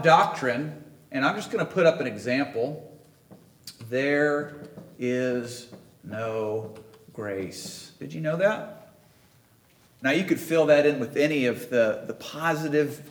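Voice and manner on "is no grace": drawn out, soft and low, each word weighted; slow dramatic stress. Acted. Preacher telling the congregation there is no grace, slow and hushed. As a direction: let it land slowly and quietly.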